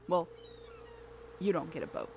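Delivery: ordinary talk, nothing marked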